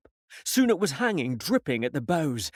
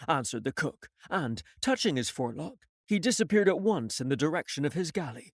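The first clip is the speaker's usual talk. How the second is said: usual speaking voice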